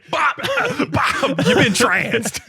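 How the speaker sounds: high laughing yell; laughing shout. Pretend. Laughing mock shout at Bob.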